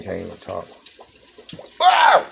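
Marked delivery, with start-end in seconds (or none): 1.8-2.2 high, loud delighted yell; excited whoop. Real, not acted. Cook yelling at viewers over the fish, excited. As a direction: shout for joy at the sizzling fish.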